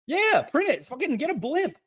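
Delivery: high-pitched, defiant rant; mad. Real, not acted. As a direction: declare your anger defiantly. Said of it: "I am mad".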